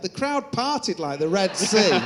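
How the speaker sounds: laughing through the punchline; real laughter at own embarrassment. Real, not acted. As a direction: laugh as it goes wrong. Laughing at his own mishap.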